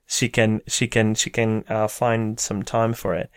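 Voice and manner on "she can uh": ordinary talk, nothing marked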